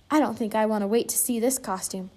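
ordinary delivery

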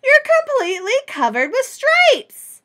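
high, loud exclamation of alarm; aghast storybook mother, pitched high and loud; shocked cry. Acted. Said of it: "she cried".

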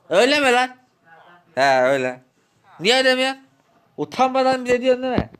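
shocked, then indignant; şaşkın tepki. Acted. Borç haberine şaşırıp çıkışma. (borcu duyunca şaşkın ve öfkeli çıkış)

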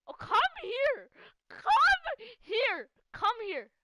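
high loud call to a friend. Real, not acted. Player calling friend over, loud high call. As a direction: yell them over to you.

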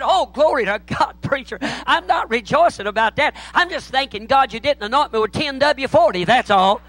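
hammed-up revival-style rejoicing, high; hallelujah praise spell. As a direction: cry out like a mock overjoyed churchgoer. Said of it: "broke out in one of them old-fashioned Baptist-costal, hallelujah, praise God spells".

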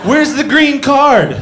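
high, loud, slow taunting yell; hammed-up jab shouted at the screen; mock yell. Comic heckling Dora on TV, high slow mock yell.

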